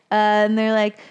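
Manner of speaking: normal talking voice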